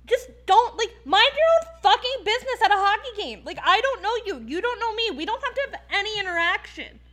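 high, heated scolding; exasperated rant. Real, not acted. Fan telling meddlers to back off, exasperated rant. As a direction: let it pour out high and insistent.

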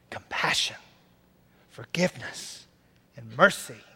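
soft, low, near-whispered list; hushed. Real, not acted. Preacher naming the Father's traits, hushed. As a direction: let each word sink in quietly.

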